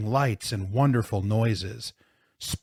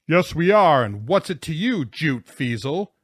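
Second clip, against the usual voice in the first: loud, higher, combative shout; roaring; 5 semitones higher, 6 dB louder than usual. A narrator's reading. Jonathan answering the plow driver, roaring. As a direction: roar back a challenge. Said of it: "Jonathan roared".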